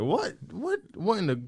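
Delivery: high, incredulous mock what; high-pitched startled whats, half joking; retold disbelief. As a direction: act stunned at the twist. Retold shock at British accent.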